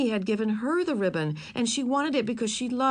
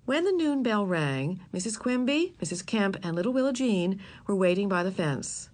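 ordinary delivery

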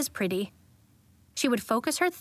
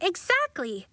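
high-pitched, eager agreement; Nori; 7 semitones higher than usual. A narrator's reading. Nori backs Marigold on the pretty campus, excited cry. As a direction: cry it out brightly, pitch lifted. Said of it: "cried Nori".

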